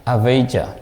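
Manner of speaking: "avijja" with v and j, ah-VAY-jah; foreigners' pronunciation. Shown as a demonstration. Teacher showing how other countries say it, international pronunciation of "avijja". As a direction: pronounce "avijja" as foreigners do, v then j. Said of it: "they pronounce".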